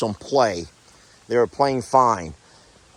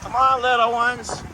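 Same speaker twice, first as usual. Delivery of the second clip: higher, sing-song voice for animals; 9 semitones higher than usual. Real, not acted. Farmer greeting her goats at the barn in higher cooing baby talk. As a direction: coo at the goats like little babies.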